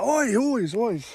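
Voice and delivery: high, loud mock British call; British oi-ing. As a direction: bellow it like a Brit charging over. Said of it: "They oi a lot".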